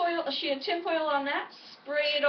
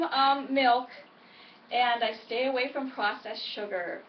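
ordinary talk, nothing marked

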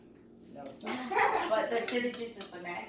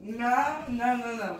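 protesting; 3 semitones higher, 7 dB louder, slower than usual. Real, not acted. Protesting over the sundae.

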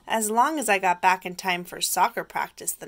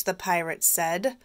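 ordinary delivery

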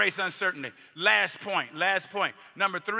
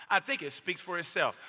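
usual speaking voice

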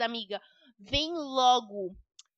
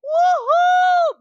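high, loud whoop of joy; cheering whoop; 13 dB louder than usual. Acted. Daughter celebrating taking mum's car, loud whoop. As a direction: whoop like you just got away with it.

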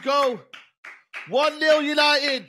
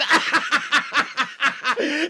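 breathy giggling laughter; 2 semitones lower, 4 dB quieter than usual. Real, not acted. Fan laughing at his Lashford joke. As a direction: laugh at your own joke.

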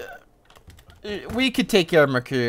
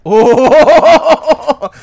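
loud, much higher exclamation; startled yelp; 11 semitones higher, 14 dB louder than usual. Real, not acted. Gamer startled in a fight, loud yelp. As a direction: caught off guard.

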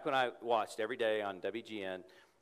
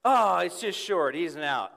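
deflated announcer; 6 semitones higher, 10 dB louder than usual. Retold or imitated. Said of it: "He would say".

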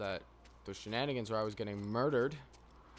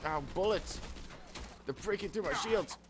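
alarmed yelp; 8 semitones higher than usual. Real, not acted. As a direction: cry out as the shields crack. Shields breaking in firefight.

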